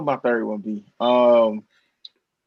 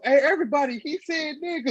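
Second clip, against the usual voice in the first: high, playful announcing to a crowd; pretending to set up a friend, calling out; mock call-out; 11 semitones higher than usual. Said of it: "we gonna drop him off".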